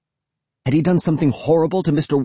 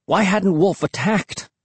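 ordinary delivery

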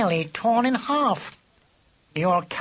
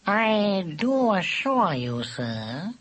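slow, smooth, courtly assurance; silky; 4 semitones higher, much slower than usual. A narrator's reading. Cat placating an offended badger, silky. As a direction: pour it out silkily, unhurried. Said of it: "he said silkily".

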